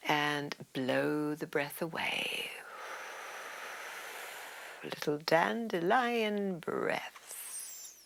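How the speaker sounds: soft, slow meditative guidance with drawn-out words; guided breathing. Real, not acted. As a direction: ease them through the breath, hushed and unhurried. Guides breathing exercise, softer than instructions.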